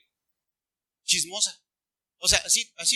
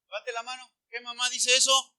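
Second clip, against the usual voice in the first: ordinary delivery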